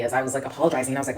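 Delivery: ordinary talk, nothing marked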